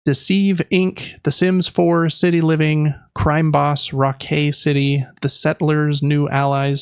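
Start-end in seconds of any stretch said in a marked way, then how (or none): none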